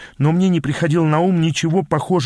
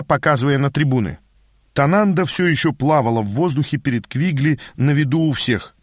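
ordinary delivery

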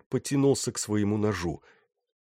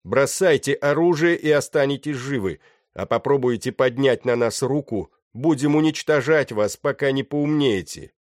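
raised, louder voice of command; shouted command; 6 semitones higher, 5 dB louder than usual. A narrator's reading. Pirate leader threatening the surrounded sailors, shouted command. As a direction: call out the ultimatum to a crowd. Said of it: "снова крикнул Серый".

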